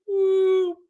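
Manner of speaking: high whoop of a perfect drive. Retold or imitated. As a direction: sing out the ball flying. Mimicking a golf drive.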